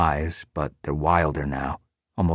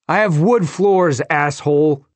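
furious scream down the phone, higher and louder; 9 semitones higher, 7 dB louder than usual. Acted. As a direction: scream it at the caller. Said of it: "I scream into the phone".

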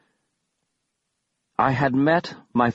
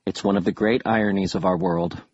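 ordinary delivery

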